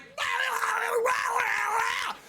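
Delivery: high, garbled roaring babble; wild yell. Retold or imitated. Storyteller imitating his bush ambush, wild gibberish yell. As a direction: leap out yelling nonsense. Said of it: "jumped out of the bush and was like".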